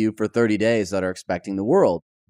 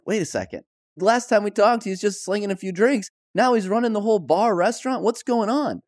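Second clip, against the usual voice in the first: pitched well up, baffled and incredulous; retold disbelief; 8 semitones higher than usual. Friend recalls finding him running the bar, pitched-up disbelief. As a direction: play back the moment it didn't add up, voice climbing. Retelling his shock at the bar.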